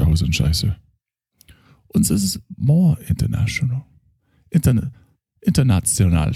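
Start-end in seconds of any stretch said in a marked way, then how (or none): 1.9-6.3 put-on German accent. German therapist character.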